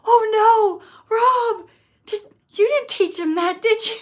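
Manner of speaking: high, loud retold panic; mock alarm. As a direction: act out a horrified parent questioning a partner. Acts out alarm to partner.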